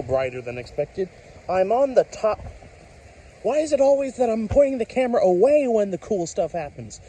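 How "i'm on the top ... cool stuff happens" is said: thrilled, pitch shooting up at the lightning; excited. Real, not acted. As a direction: voice jumping high with excitement. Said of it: "my voice always jumps whenever I see lightning".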